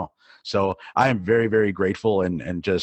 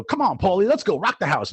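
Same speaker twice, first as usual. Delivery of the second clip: lightly imitated hype-up, pitched higher; mock pump-up of the young DJ by his MC friend; hype MC call; 9 semitones higher than usual. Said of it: "he'd go".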